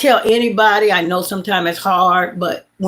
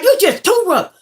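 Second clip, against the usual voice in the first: scolding the dog; 12 semitones higher, 3 dB louder than usual. Retold or imitated. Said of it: "I'm like".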